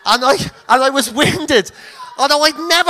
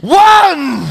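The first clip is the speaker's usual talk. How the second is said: loud, higher, drawn-out count; referee's count; 4 semitones higher, 5 dB louder than usual. Retold or imitated. Referee counting out a downed boxer, loud mock count. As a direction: call it out like a referee. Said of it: "And he just goes".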